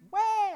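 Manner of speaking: high, loud mock baby wail. Preacher mimics a hungry baby's loud wail. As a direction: squall like a hungry infant. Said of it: "that little baby is hungry".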